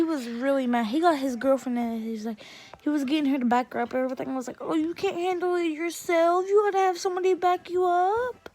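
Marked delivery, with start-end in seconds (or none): 4.6-8.5 higher, sing-song jeer; mock him for needing help; taunting. Taunting him over needing backup.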